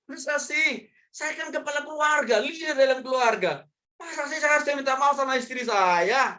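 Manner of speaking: high-pitched mock outrage; whine-protest as the proud head of the household; indignant husband. (suami gengsi protes, melengking dibuat-buat)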